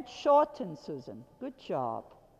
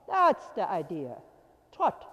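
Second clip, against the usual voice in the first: ordinary talk, nothing marked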